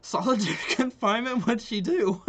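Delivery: loud, high-pitched incredulous outburst with a laugh; shocked disbelief. Real, not acted. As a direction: exclaim it in amused shock, way up in pitch. Shocked reading of character's punishment.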